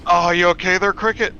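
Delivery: mock concern. Real, not acted. Teasing friend who laughs.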